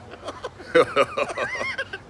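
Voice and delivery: shrill delighted laugh; high squeal of laughter. Real, not acted. Laughs at pet.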